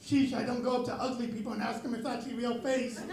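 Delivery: normal talking voice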